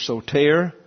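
"soter" stressed on "-ter"; stress on second syllable. Shown as a demonstration. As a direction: land on the last syllable of "soter", so-TAIR. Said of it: "emphasis again on the second syllable".

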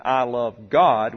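"God" loud and drawn out after I love; stress on GOD. Shown as a demonstration. Preacher shows reciprocal love, stress on God. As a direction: lean slowly into "God". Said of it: "with emphasis on Him".

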